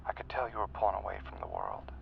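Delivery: intimate, predatory murmur; hushed menace. Acted. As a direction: confide it quietly, sinking into a whisper. Quietly reading his victim's weakness.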